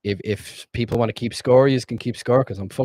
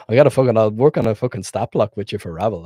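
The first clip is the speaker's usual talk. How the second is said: ordinary delivery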